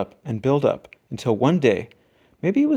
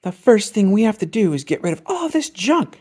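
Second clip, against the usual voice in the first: higher, firmer mother voice; Mama fed up; 6 semitones higher, 3 dB louder than usual. A narrator's reading. Mama who lost her temper, clearing the room firmly. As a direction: lay down the law, exasperated. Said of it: "she said".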